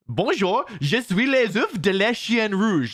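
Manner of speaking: hammed-up French delivery; mock French voice. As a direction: play at speaking French, stretching the words. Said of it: "That's what my real French sounds like".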